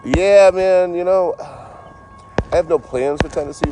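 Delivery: high, loud, slow put-on voice; put-on goofy voice. Answering chat about Tennessee in a high goofy voice.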